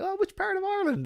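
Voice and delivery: very high, quick excited voice; retold eager question. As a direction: imitate an excited new acquaintance asking.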